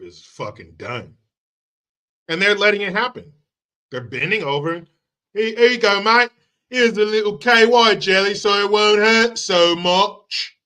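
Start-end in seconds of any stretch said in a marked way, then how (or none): 5.3-10.6 high, loud mock British helper; a cheeky British shopkeeper voice; mock obliging Brit. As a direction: hand it over like a chummy bloke. Mocking Brits giving in.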